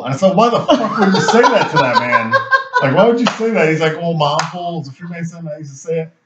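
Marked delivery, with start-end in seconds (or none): 0.4-3.7 high-pitched, half-laughing disbelief; incredulous demand. Retold or imitated. Retells confronting his buddy in disbelief. 4.0-6.1 higher, much softer imitated excuse; sheepish excuse. Acts buddy's sheepish excuse.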